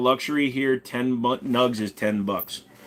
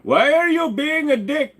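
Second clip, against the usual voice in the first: much higher, louder and slow; scolding put-on voice; 5 dB louder than usual. Scolding voice in a mock dialogue.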